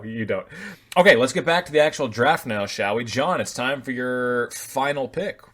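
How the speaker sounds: usual speaking voice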